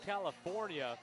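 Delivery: ordinary talk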